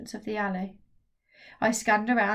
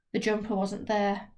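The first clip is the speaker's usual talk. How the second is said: ordinary delivery, nothing marked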